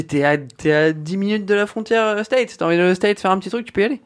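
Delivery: eager rush of excitement; excited. Real, not acted. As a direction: gush about it, pitch climbing. Raving about living near Vancouver.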